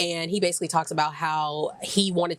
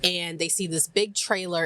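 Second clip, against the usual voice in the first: ordinary delivery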